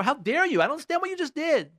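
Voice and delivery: put-on high-pitched exasperated outburst at a little child; tough parent scolding. Tough parent to a little child over a spilled drink, high scolding. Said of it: "people who are, who are tough with their children".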